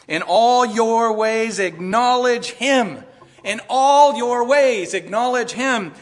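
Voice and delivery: slow, loud, high, each word weighed; emphatic scripture quote. Real, not acted. Preacher proclaiming Proverbs to the congregation, slow and loud. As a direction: proclaim the verse slowly and loudly.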